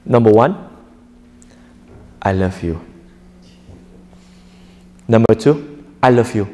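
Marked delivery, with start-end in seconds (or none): 2.2-2.8 low, sincere and soft; mean every word, heartfelt; serious heartfelt declaration; from the heart. Shown as a demonstration. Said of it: "Serious from the heart". 6.0-6.5 low, half-hearted and hesitant; a reluctant i love you. Shown as a demonstration. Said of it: "a bit reluctance".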